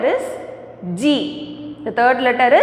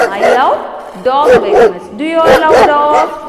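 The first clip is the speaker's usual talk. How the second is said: enthusiastic; 6 dB louder than usual. Real, not acted. Teacher enthusing to students.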